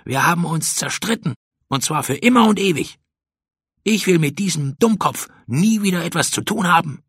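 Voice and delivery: put-on high, cross child's voice; indignant vow. A narrator's reading. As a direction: swear off your friend for good. Pauli swears off friend.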